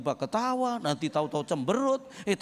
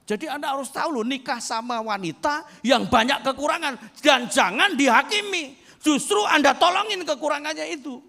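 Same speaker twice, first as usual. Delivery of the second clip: higher, louder, fast preacher's shout; preaching at full force; 9 semitones higher, 7 dB louder than usual. Acted. Preacher exhorts husbands to help their wives, loud fervent shout. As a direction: hammer the point home to the men.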